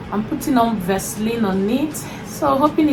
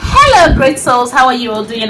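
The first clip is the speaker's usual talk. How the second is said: excited greeting; 3 semitones higher, 8 dB louder than usual. Real, not acted. Energetic vlog greeting.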